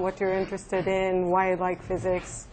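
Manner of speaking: normal talking voice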